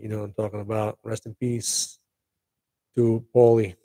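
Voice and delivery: ordinary talk, nothing marked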